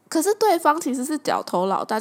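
ordinary talk

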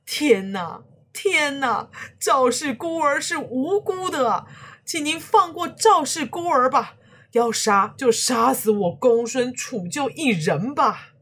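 high, loud desperate begging; pleading. A narrator's reading. Old man begs the villain to spare the orphan, pleading. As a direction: cry out for mercy for the baby. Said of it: "哀求".

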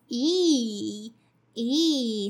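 E as a long ee. Shown as a demonstration. Teacher showing the ee ending. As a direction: say E, stretched ee. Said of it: "a long I sound".

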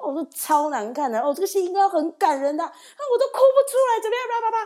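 mock the show like catty seniors; high sarcastic whine of fake tears; snide mocking critique. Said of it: "嘴巴很贱啊".

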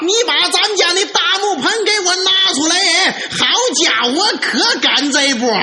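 high-pitched showy yell; hollering. Acted. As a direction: shout it out so all the neighbours know. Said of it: "他一边扯着嗓子这么一喊".

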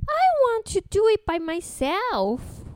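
put-on a stubborn little girl insisting; little princess. A narrator's reading. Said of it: "said the little princess".

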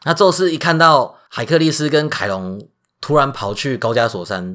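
ordinary delivery

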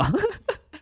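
can't hold the giggle in; high giggle. Real, not acted. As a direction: burst of high-pitched giggling. Recalls teen's serious question.

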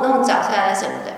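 usual speaking voice

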